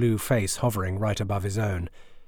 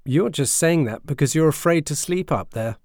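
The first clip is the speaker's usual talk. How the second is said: mocking; 6 semitones higher, 5 dB louder than usual. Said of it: "Alvin mocked".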